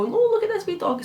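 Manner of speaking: squeaky high-pitched coo over a puppy photo; sky-high baby-talk squeal at a dog. Retold or imitated. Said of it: "I go".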